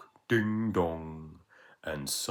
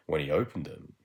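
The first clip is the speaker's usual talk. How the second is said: plain reading voice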